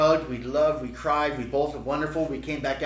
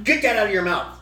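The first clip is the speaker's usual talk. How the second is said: put-on higher-pitched warning to a child; retold parental scolding; 5 semitones higher, 4 dB louder than usual. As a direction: act out a parent's firm order. Parent scolding toddler in retold story.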